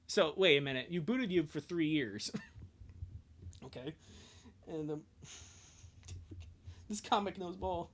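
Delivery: normal talking voice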